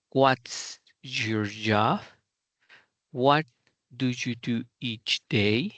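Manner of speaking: slow, What's and What said strong, each question sinking at the end; teacher demo of WH questions that start strong and go down; falling question tune. Shown as a demonstration. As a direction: punch the question word, then slide down. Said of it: "empezamos fuerte y nos vamos hacia abajo".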